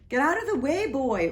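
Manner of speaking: put-on the king ordering the boy away; commanding shout. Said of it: "ordered the king".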